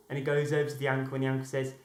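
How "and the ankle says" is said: usual speaking voice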